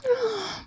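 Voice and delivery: sharp high gasp; startled gasp. Acted. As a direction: wake with a jolt. Cookie waking with a start.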